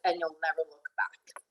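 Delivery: usual speaking voice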